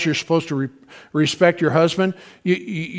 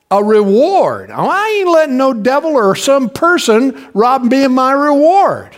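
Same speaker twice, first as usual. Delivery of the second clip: louder, rising preacher's build, higher; fiery preaching; 4 semitones higher, 3 dB louder than usual. Acted. Pastor rousing the congregation, fiery preaching. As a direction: preach it home to the church.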